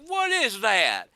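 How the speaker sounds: put-on a neighbor squints at the lamp, thick Midwest accent. Neighbor asking the dad about the lamp, thick Midwest accent. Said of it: "he affects this really thick Midwest accent".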